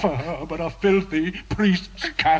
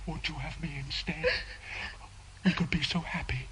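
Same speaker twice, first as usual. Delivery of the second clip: much softer, hushed, whispering the promise; soft whispered pleading; 6 dB quieter than usual. Acted. Priest luring the prisoner away, whispered and soft. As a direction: tempt her in a near whisper. Priest tempts her softly.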